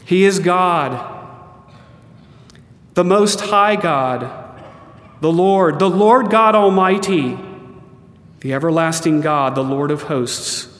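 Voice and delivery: slow, ringing litany of divine names; solemn proclamation. Acted. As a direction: lift each name up like a herald.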